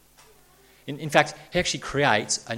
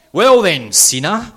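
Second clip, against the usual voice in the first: louder accusing "Well then, sinner"; the law of God; 7 semitones higher, 10 dB louder, slower than usual. Acted. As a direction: call him out like a judge. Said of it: "says the law of God".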